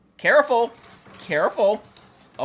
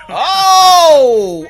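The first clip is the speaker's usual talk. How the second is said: surprised shout; 9 semitones higher, 11 dB louder than usual. Real, not acted. Big jump.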